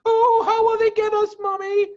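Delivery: put-on a child asking Mommy in fear; scared little fox. A narrator's reading. Small fox frightened asks mother.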